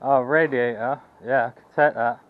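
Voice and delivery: usual speaking voice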